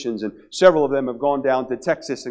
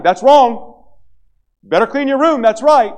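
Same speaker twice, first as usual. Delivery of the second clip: bossy big sister; 10 semitones higher, 10 dB louder, slower than usual. Retold or imitated. Older sister bossing little brother.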